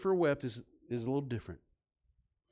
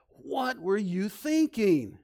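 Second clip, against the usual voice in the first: put-on slow, high, exasperated parent scolding a child; a frustrated parent asking in disbelief; 7 semitones higher, 6 dB louder, slower than usual. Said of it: "every parent knows when you go".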